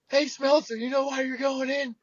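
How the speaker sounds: high-pitched taunt from the stands; mock ballpark jeering; imitated heckling fan.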